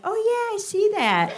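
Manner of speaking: high, airy mocking of agreement; mock agreeing classmates. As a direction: lampoon the nodding classmates.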